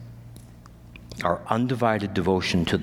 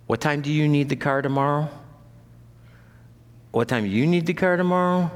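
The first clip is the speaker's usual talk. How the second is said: quick, higher-pitched imitation of car planning; mock busy household check, fast and raised; hurried logistics question; 6 semitones higher, faster than usual. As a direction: rattle off the question as if juggling schedules. Acting out juggling one car.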